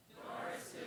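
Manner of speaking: unvoiced whisper of the listener; whispered reply. Comedian whispers the listener's half of a knock-knock joke. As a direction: whisper it back like the other side of the door.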